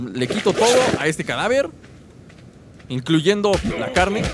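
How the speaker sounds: ordinary delivery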